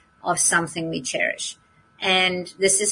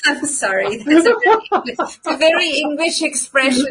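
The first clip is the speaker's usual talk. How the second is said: laughing apology; 7 semitones higher, 3 dB louder than usual. Real, not acted. Laughing at her own phrase.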